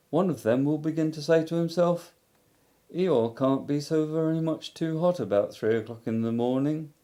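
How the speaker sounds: a lightly voiced, mournful Eeyore; gloomy donkey Eeyore. A narrator's reading. Eeyore grumbling to Christopher Robin, mournful. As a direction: let the donkey's self-pity sag through.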